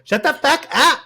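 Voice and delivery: high, loud mock outburst; Abel impression. Host showing how they'll tell friends off, mock outburst. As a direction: belt it out as a hammed-up tantrum. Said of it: "without hearing Abel's voice in your head".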